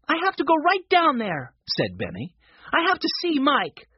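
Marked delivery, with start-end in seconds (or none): 0.1-1.5 put-on high-pitched, urgent child; eager young boy, voice up high; insistent little boy. A narrator's reading. Benny insisting he must go. 2.7-3.9 put-on high-pitched, urgent child; eager young boy, voice up high; insistent little boy. A narrator's reading. Benny insisting he must go.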